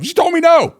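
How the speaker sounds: high and loud disbelief; indignant. Real, not acted. Punchline of a grievance.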